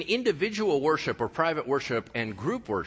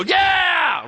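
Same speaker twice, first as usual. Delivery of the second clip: a sudden, much higher and louder shout of praise; declarative shout; 14 semitones higher, 9 dB louder than usual. Retold or imitated. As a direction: belt it out like a shout to God. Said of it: "they're gonna go".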